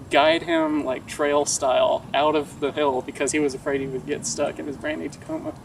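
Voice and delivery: normal talking voice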